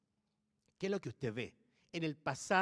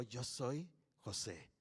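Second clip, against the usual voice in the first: much softer, lower, slow reveal; hushed reveal; 6 semitones lower, 10 dB quieter, slower than usual. Acted. Joseph quietly tells his brothers who he is, hushed and slow. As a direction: let the words fall quietly, stunned. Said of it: "No sé con qué tono".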